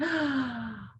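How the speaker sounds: a soft, delighted intake of breath; mock delighted gasp. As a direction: gasp as if you walked into heaven.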